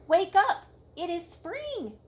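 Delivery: high, loud wake-up call easing off; excited shout. A narrator's reading. Frog calling Toad out of bed, excited. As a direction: burst in with springtime news. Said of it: "shouted Frog".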